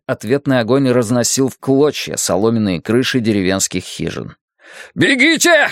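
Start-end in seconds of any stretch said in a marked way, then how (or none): 4.9-5.7 high, urgent shouted order; colonel's shout. A narrator's reading. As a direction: shout it to get everyone running. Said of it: "крикнул полковник Магоро".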